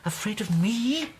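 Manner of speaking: repeating it slowly, dazed and rising; can't believe what he's hearing; asking himself aloud, thrown off balance; stunned disbelief. Acted. Accused man stunned by wife's fear.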